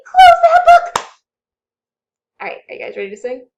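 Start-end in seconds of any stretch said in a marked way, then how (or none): 0.1-0.9 very high, loud urgent shout; mock panic. Teacher yelling at the kids to shut the book, playful panic. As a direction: yell it to shut the danger away.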